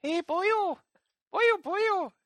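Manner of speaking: put-on a very high, squeaky child's "boyo"; Welsh toddler niece. Dreading the Welsh niece's first words, squeaky high child voice. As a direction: squeal it like a tiny kid copying the grown-ups. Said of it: "she'll be like".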